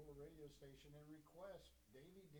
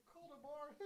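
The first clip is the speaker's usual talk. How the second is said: high, louder dismissive call tomorrow; mock booker brush-off; 13 semitones higher, 9 dB louder than usual. Imitating bookers who brush him off, high mock voice. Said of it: "those same people that I call for the bookings that say".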